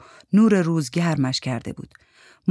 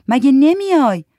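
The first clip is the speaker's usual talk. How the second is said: Giti's voice; 9 semitones higher, 7 dB louder than usual. Acted. Giti pleads with Suri.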